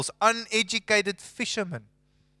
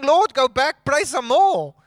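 much higher and louder, incredulous; mock exasperation; 7 semitones higher, 7 dB louder than usual. Preacher joking with congregation, high and incredulous. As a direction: plead with the Lord in disbelief. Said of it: "And I'm thinking".

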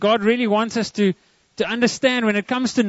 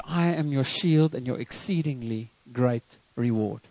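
low, softer, measured quote of God; solemn divine promise; 8 semitones lower, 7 dB quieter than usual. Retold or imitated. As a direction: deliver God's promise quietly and solemnly. God's words quoted solemnly to congregation.

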